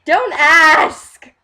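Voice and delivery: loud, high-pitched shout to drop it; exclaimed brush-off. Real, not acted. Host fending off co-host's licorice question, loud high outburst.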